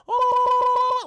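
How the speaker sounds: high, loud drawn-out cartoon whoop. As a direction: imitate an old cartoon war cry.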